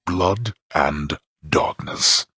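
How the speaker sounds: "Blood and darkness" dragged out word by word, with weight on "Blood"; every word of the curse drawn out; slow, deliberate. Acted. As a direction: spell out the curse slowly so the boy repeats it. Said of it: "Say it with me".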